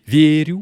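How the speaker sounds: "vieru" with the first syllable drawn out and stressed. Shown as a demonstration. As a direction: stress the start of "vieru", VIE-ru. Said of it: "la première syllabe".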